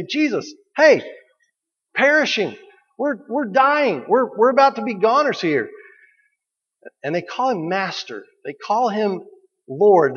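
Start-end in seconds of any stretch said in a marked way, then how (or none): 0.0-5.7 high-pitched, urgent panic; panicked disciples. Acted. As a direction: plead like men about to drown. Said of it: "they grab him and they say".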